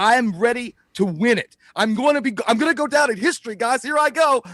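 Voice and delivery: hammed-up overconfident hero; cocky hype. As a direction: psych yourself up before the boss fight. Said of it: "That guy was like".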